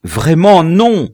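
loud, high, drawn-out protest; exclaimed denial; crying out. A narrator's reading. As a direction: cry out a desperate denial. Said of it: "s'écria le citoyen général".

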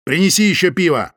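loud cold order; harsh command. Acted. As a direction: bark the order. Лёня orders Соня.

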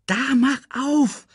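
high, slow meerkat voice; coaxing meerkat. Acted. Meerkat giving the cub water, high slow coaxing. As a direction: coax slowly in a high pitch.

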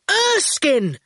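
high, exasperated cry of a name; protesting shout. A narrator's reading. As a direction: shout to stop him. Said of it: "Howard shouted".

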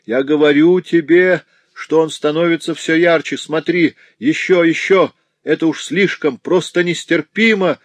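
high, loud, desperate insistence; agonised insistence. A narrator's reading. Suffering notary insisting the light grows brighter, agonised. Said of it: "выражало глубокое страдание".

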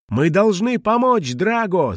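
put-on girl shouting to rescue Drago; urgent shout. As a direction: call everyone to help. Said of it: "закричала Мона".

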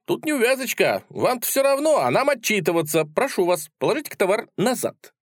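high, officious seller voice; fussy shop assistant. A narrator's reading. Seller asks customer to return goods, fussy high voice. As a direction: explain the snag in a high voice.

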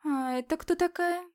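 a sly forest witch asking; witchy woman voice. Acted. Woman in red asks.

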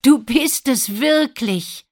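high, loud, drawn-out amazement; joyful exclamation. A narrator's reading. Prince is certain it is Lina, crying out with joy. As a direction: exclaim it slowly, overjoyed. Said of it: "ruft der Prinz".

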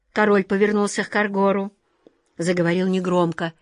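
usual speaking voice

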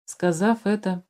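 usual speaking voice

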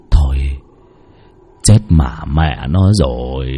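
low, slow, hopeless mutter; dread mutter. Acted. Old man's terrified thought. (lẩm bẩm trầm, chậm, sợ hãi)

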